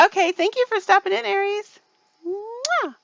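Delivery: high, loud sing-song thanks; cutesy farewell. Real, not acted. Host thanking a viewer for stopping in, cutesy high voice. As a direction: chirp it sweetly up high with a kiss.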